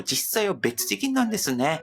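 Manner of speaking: ordinary talk, nothing marked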